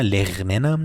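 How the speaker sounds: لغننم with a guttural gh for R; wrong throaty R. Shown as a demonstration. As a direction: drag the R of لغننم in the throat, slowly. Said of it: "لغننم نه".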